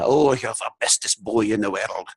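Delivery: imitated Scottish accent, a bit drunk Mrs Doubtfire; [name], Scottish accent. Grandfather saying it to his grandson, put-on Scottish accent. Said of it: "Scottish accent".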